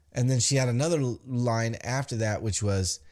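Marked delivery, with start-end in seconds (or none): none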